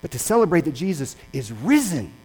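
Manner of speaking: loud, rising sermon climax; full-force preaching. Real, not acted. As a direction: preach it out to the whole congregation. Preaching climax on risen Christ.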